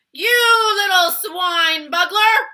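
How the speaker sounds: slow, very loud, high giant roar; furious giant roaring at the BFG; roared insult. A narrator's reading. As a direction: bellow the insult slowly. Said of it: "roared the blood bottler".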